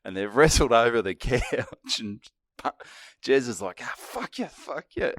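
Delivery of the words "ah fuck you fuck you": high-pitched but soft mock cursing; small, squeaky mock outburst; retold wrestling curses. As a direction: act out a struggling mate, high and held back.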